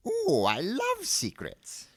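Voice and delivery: very high, drawn-out delight; excited old man. A narrator's reading. Old wand seller hearing of a secret, excited. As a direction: squeal it slowly with relish. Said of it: "said Mister Ollivander excitedly".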